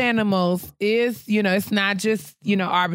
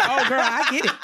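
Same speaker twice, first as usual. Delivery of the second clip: higher, lightly voiced friend; understanding friend; 5 semitones higher than usual. LaToya backing her up, a light high reassurance. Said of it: "She was like".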